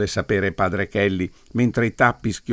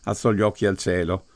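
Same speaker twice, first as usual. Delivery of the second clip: ordinary delivery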